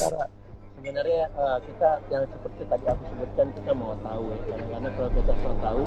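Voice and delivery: the line fading out, words barely there. Real, not acted. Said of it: "Suaranya makin hilang".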